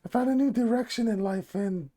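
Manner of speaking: usual speaking voice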